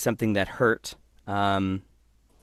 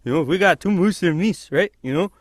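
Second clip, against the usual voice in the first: put-on high-pitched Canadian hoser accent; chatty cartoon Canadian hoser voice; 9 semitones higher, 4 dB louder than usual. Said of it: "doing, like, a hoser thing".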